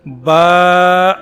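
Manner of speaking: با stretched long and loud; drawn-out letter. Shown as a demonstration. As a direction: pull out the vowel of با. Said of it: "با کو کھینچ کے پڑھیں گے". (با کو کھینچ کر بلند آواز میں)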